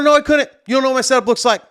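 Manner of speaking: high, insistent pushback; mad retort. Retold or imitated. DJ disputing that he could move the speaker, angry retort. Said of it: "getting even more mad".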